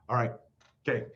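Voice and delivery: ordinary talk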